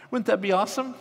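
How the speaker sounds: high, eager question; excited. Real, not acted. Preacher thrilled, asking the congregation. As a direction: lift it with pure delight. Preacher imagining God in garden.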